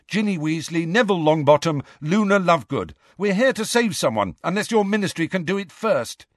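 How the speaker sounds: high, hurried insistence; very quickly. A narrator's reading. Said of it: "Harry said very quickly".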